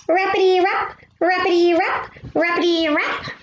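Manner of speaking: high, yappy dog barks; barking dog voice. A narrator's reading. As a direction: bark it out like a small dog. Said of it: "and barks".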